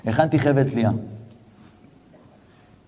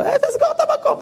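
mocking echo. Shop owner mocks rabbi's request.

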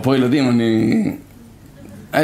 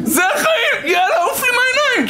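mock parent shout. Parent yelling at a complaining child, high laughing shout. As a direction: bellow it, get out of my sight.

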